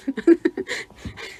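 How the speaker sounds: breathless high laugh; unvoiced giggle; airy laugh. Real, not acted. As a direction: laugh at your own silliness.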